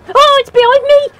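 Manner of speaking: high, loud panicked yelp; being chased. Real, not acted. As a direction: cry out as it catches you. Monster chasing player in game.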